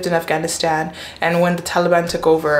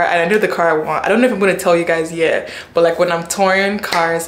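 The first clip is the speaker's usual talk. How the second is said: ordinary talk, nothing marked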